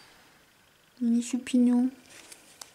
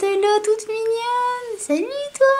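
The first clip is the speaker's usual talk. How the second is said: cutesy voice; 13 semitones higher than usual. Real, not acted. Greeting a cute card.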